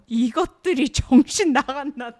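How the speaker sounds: fast, loud Korean snap; angry Korean quote. Retold or imitated. Friend quoting the jealous hero, fast loud Korean. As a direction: rattle off the line in disbelief.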